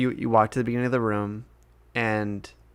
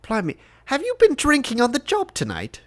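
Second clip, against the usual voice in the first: high-pitched incredulous lord; Lord Scheinheimer, indignant; 14 semitones higher, 3 dB louder than usual. Acted. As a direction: scold a servant in a high, put-on voice.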